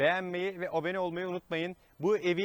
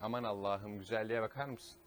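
hushed awe; 6 semitones lower, 5 dB quieter than usual. Real, not acted. Awed by the view.

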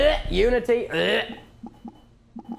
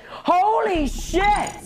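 high, stretched-out cry of shock; real startled outburst; startled exclamation; 10 semitones higher, much slower than usual. Real, not acted. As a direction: blurt it high, drawing out both words. Startled by loud surprise.

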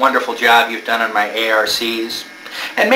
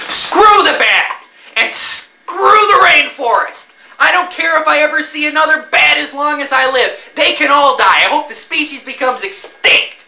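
furious rant; 12 semitones higher than usual. Acted. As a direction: rage at the bat and rainforest. Angry rant after attack.

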